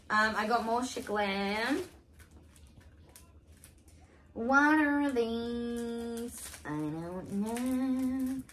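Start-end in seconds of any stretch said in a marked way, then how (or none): 4.3-8.4 slow puzzled drawl. Real, not acted. Drawn-out puzzled.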